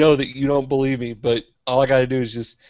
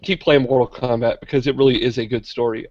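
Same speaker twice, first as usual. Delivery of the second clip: usual speaking voice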